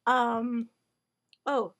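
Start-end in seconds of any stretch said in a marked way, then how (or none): none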